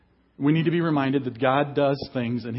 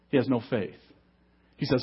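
ordinary talk, nothing marked

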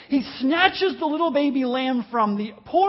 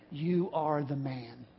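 slow, much softer and lower, heavy and grave; solemn accusation; 8 semitones lower, 8 dB quieter, slower than usual. Retold or imitated. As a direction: drop it slowly and quietly like a final verdict. Prophet's accusing verdict line.

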